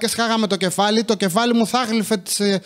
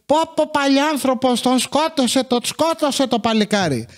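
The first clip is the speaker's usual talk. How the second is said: put-on high old-lady gasping at the TV, pitched way up; old neighbour women; 6 semitones higher than usual. Old neighbour ladies react to the soap opera in high old voices. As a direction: cluck like a village granny watching a soap. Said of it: "και να λέει".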